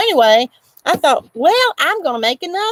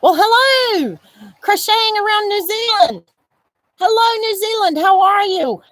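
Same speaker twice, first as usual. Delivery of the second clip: excited greeting; 11 semitones higher than usual. Real, not acted. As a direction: call out a delighted welcome to a far-off viewer. Greeting viewers from New Zealand.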